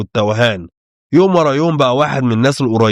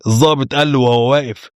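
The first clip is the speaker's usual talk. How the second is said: usual speaking voice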